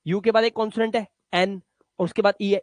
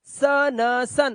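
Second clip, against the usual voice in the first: slow spelling out; 3 semitones higher, much slower than usual. Shown as a demonstration. Teacher spells words slowly for pupils, sound by sound. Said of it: "अब इसे सुन मत पढ़ना".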